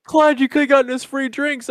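buddy complaining to Clyde, laughing. Retold or imitated. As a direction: whine it while giggling. Friend's complaint retold while laughing.

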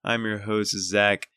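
upbeat host voice, opening the show; podcast intro. Acted. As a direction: announce the episode like a radio host.

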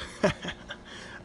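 quiet breathy dragon chuckle; a gentle mock chuckle; soft chuckle. Dragon chuckles at Rory, quiet breathy laugh. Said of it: "The dragon chuckled".

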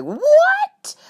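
high, loud shocked what; mock outrage. Hearing dad would kill her, mock shocked.

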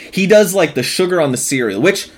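ordinary delivery

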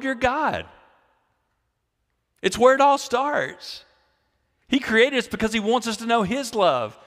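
preaching at full force. Acted. Pastor urging the congregation, impassioned preaching. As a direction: lift it up and plead with them.

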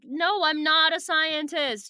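acted protest. Acted denial in retold argument.

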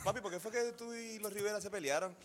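put-on a light, high-pitched teen daughter's voice; daughter at fifteen. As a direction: lightly play the grown daughter asking her dad. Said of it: "y va a decir". (voz aguda de hija adolescente, apenas imitada)

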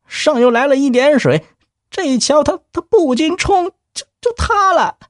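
high, stammering frightened clerk; nervous stammer. Acted. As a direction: report it trembling and stumbling.

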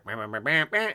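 sung la cucaracha horn; mock car horn humming La Cucaracha; imitated car horn. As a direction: mimic the tinny horn tune.

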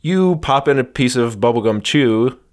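normal talking voice

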